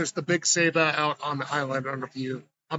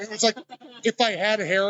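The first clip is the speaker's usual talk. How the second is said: ordinary delivery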